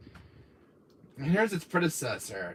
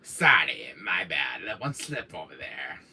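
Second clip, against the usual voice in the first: very low, smug put-on voice; low deadpan character aside; mock villain apology; 11 semitones lower, 4 dB quieter, slower than usual.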